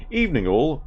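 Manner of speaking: lightly put-on English greeting; a hint of a British bloke; English accent. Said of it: "he's a pom".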